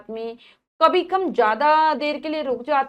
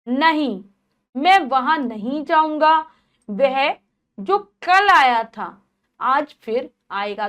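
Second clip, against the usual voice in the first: "नहीं" alone, a clear pause, then the sentence, and a break after "वह"; pauses at commas; 4 dB louder, slower than usual. Shown as a demonstration. Teacher showing pupils where the comma falls, deliberate short pauses. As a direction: stop briefly after "नहीं" and after "वह". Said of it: "वहां हम थोड़े थोड़े समय के लिए रुक रहे हैं".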